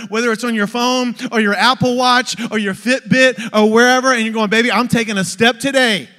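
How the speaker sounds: loud, rousing sermon climax; preaching at full force. Real, not acted. Preacher urging people to take another step, rousing shout. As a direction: drive it home to the congregation, loud.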